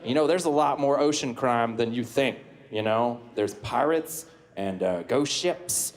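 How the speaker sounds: put-on folksy Southern accent, teasing the crowd. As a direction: play a good-ol'-boy Southern Aquaman. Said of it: "why do you have a southern accent?".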